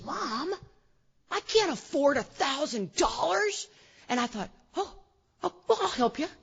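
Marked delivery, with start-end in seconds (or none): 0.0-3.7 mock-distressed protest, pitched high and drawn out; distressed son. Son to mom over the house payment, imitated high distressed protest. Son's upset protest in story. 4.7-6.3 high, halting, overeager offer; flustered mother. Retold or imitated. Mom to son who can't afford it, acted flustered offer.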